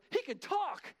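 high, loud cry of amazement; retold mother's excited outburst; astonished mom. Mom astonished that son talks.

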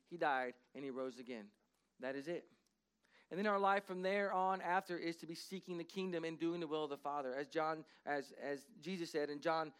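ordinary talk

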